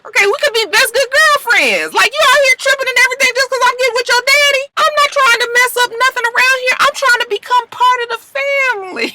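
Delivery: very high, loud mock-pleading voice; pleading girlfriend impression. Dad's girlfriend tells Grace she wants into the family, high-pitched pleading. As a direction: rush it out in a squeaky, insistent put-on voice.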